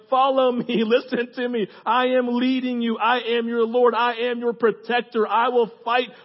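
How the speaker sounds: loud, high, declaring voice; impassioned call building to a drawn-out close; commanding loving shepherd. Acted. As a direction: proclaim it as the protecting Lord. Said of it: "He's like".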